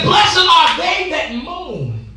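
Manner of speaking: slow, drawn-out preached verse; preached scripture. Acted.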